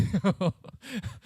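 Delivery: short real chuckle; embarrassed laugh. Real, not acted. Preacher excusing his blunt wording, short laugh. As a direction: laugh it off briefly.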